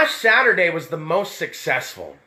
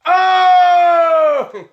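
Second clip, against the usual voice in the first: sudden high, loud oh; surprised shout; 9 semitones higher, 7 dB louder than usual. Real, not acted. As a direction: cry out as if startled. Sudden exclamation.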